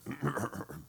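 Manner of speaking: quiet, rumbling throat clear; imitated throat clearing. Man stepping up to pray over offering, mock-solemn throat clearing. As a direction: clear the throat self-importantly. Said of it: "and he went".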